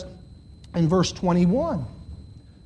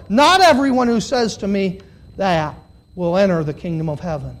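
usual speaking voice

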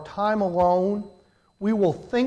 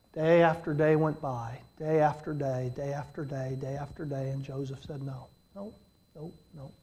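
fading repetition; 5 semitones lower, 8 dB quieter than usual. Acted. Preacher to the congregation, fading softer with each day. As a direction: lower and softer on each repeat, down to near silence.

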